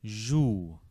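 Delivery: "Jou" said zhoo, held oo; syllable demo. Shown as a demonstration. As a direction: model "Jou" for the learner. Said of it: "the double O".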